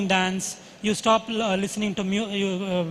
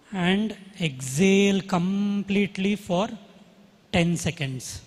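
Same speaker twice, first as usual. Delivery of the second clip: guided meditation; slower than usual. Acted. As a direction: guide the breathing calmly, slowly. Leads a breathing meditation.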